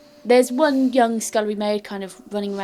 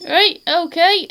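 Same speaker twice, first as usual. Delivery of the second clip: higher, louder, slow servant's reply; gatekeeper voice; 9 semitones higher, 8 dB louder, slower than usual. Acted. Gatekeeper answering the party at the gate, higher and slow.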